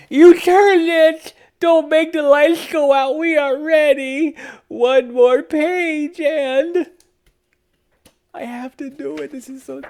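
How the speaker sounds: high, anxious pleading, fading away; pleading book characters. A narrator's reading. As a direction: plead not to let the lights go out. Characters fear the book ending.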